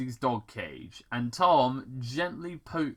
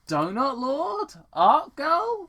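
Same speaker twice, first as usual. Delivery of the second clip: surprised; 14 semitones higher, 7 dB louder, slower than usual. A narrator's reading. Sonic wakes, names them.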